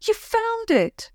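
high delighted cry; light up at the sight of it; happy surprise. A narrator's reading. Princess seeing the lost teddy, happily. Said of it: "Princess Isabel said happily".

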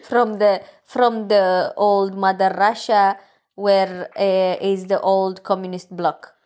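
slow, heavy mock Russian-bloc accent; Eastern European accent. Shown as a demonstration. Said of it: "did I sound Eastern European".

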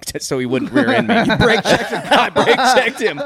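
high, loud laughing talk. Real, not acted. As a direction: laugh through the words. Laughing through story.

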